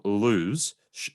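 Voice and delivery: ordinary talk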